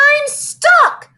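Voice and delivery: high, loud panicked wail. Acted. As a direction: wail it in alarm. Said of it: "He wailed".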